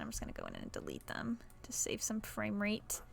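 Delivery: whispered question to yourself. Real, not acted. Quiet aside while building.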